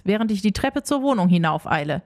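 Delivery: ordinary delivery, nothing marked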